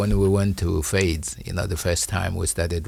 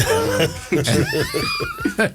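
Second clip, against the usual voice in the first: big shouting burst of laughter. Real, not acted. Host laughs at his own joke, roaring laugh. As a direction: let out a huge laugh.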